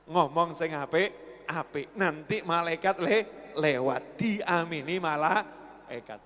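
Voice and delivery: soft slow tender reminder; gentle advice. Retold or imitated. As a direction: coax the wife kindly and slowly. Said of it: "langsung ngomong".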